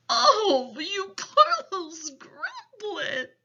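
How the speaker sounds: high, drawn-out giant exclamation; big giant wail of sympathy; pitying cry. A narrator's reading. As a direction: cry out in pity, slowly. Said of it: "cried the B F G".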